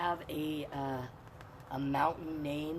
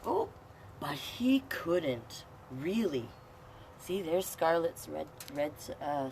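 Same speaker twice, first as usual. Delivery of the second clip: ordinary delivery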